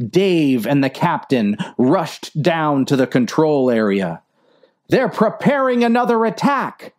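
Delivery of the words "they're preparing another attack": high, alarmed exclamation; exclaimed alarm. Acted. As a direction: blurt out the new danger. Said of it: "exclaimed Dave".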